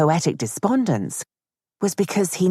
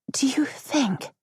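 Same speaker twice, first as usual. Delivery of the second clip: a higher, unvoiced whisper, slowly; trembling voice; 11 semitones higher, 4 dB quieter, slower than usual. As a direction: nervously breathe the question. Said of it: "her voice trembling".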